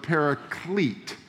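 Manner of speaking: "paraclete" slow, set apart from parakeet; "paraclete", each syllable spaced out; correct pronunciation. Shown as a demonstration. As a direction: land on "paraclete" deliberately. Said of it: "not parakeet".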